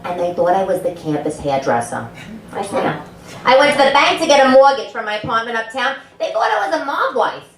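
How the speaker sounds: normal talking voice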